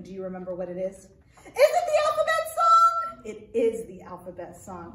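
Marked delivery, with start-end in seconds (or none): 1.0-3.2 high, loud puppet asking eagerly; squeaky puppet voice. Acted. Puppet Arturo asks the librarian about the song, high puppet voice. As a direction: pitch way up as a curious monkey puppet.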